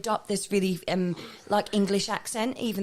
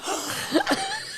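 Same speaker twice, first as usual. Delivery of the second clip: high, sharp gasp; gasp of awe. Retold or imitated. Telling how she first saw Louise, gasping. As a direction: gasp like the world just stopped.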